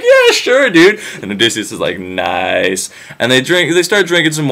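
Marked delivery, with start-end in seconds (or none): 0.0-1.0 much higher, easygoing surfer-dude host; laid-back dude. Retold or imitated. Eumaeus welcoming the beggar, chill high dude voice. 2.0-2.9 lower, softer pleased murmur; quietly pleased with the test; low satisfied nice. Retold or imitated. Odysseus to himself, low pleased murmur.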